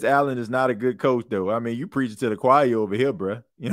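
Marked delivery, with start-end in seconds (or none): none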